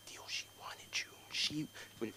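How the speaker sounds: unvoiced secretive whisper. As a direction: lean in and whisper. Nephew confiding secret.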